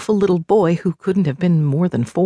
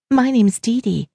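lightly voiced higher deer woman; a hint of a higher-voiced deer shifter; 8 semitones higher than usual. A narrator's reading. Deer shifter introduces herself.

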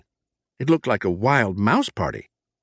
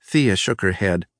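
plain reading voice

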